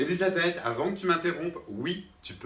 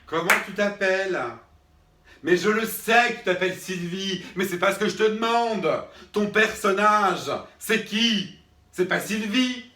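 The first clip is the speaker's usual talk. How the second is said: louder and higher, snapping at the student; exasperated shout; 5 semitones higher, 6 dB louder than usual. Acted. Teacher exploding at Sylvie who won't answer, exasperated shout. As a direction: blow up at her, fed up.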